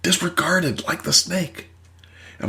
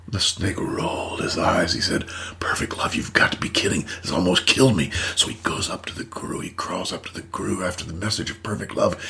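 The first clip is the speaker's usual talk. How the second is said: softer, whispered parable narration; hushed storytelling; 7 semitones lower, 3 dB quieter than usual. A narrator's reading. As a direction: lean in and tell the fable quietly. Tells the snake parable softly.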